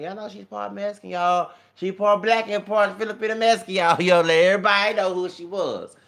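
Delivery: excited shouting, high and very loud; proud loud announcing. Retold or imitated. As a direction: holler it out to everyone. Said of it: "I was letting all my men".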